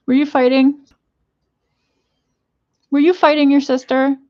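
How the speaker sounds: high, loud scolding of the pets; scolding shout. Real, not acted. Owner scolding her pets, loud and high. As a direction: yell at the fighting animals.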